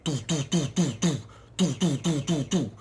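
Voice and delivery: mock thud sound effect; imitated thuds. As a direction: beat out the kicks as sounds. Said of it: "It's like".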